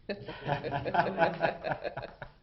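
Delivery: quick amused laugh; short laugh. Real, not acted. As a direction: chuckle at your own joke. Chair laughs at own joke.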